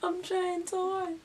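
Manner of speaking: high, loud, drawn-out comic plea; exaggerated wail. Storyteller confessing her dodging effort, comic wail. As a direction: wail it slowly at the top of the voice.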